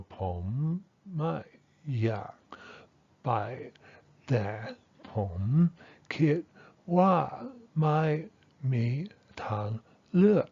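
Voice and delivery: "pom, mai, ya" onward syllable by syllable, each tone distinct; sentence-level tone contour. Shown as a demonstration. Teacher tracing the sentence's intonation with a marker, separate exaggerated tones from "pom" to "le". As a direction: trace the tones from "pom" to "le", many swung high, with gaps between words. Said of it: "here's the sentence level intonation here".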